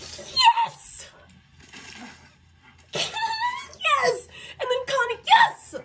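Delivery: very high, squealed cheering; real ecstatic cheer; jubilant squeal. Real, not acted. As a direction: squeal with joy at the screen. Celebrating a turn in the show.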